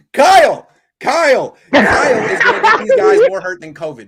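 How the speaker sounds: loud exasperated yell at the coach; shouting. Real, not acted. Fan yelling at the coach in disbelief, loud. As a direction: yell the name like a fed-up fan.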